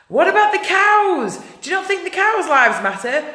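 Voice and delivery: loud, high-pitched outraged questioning, re-enacted; indignant challenge. Vegan confronts protesters about the cows, retold indignantly.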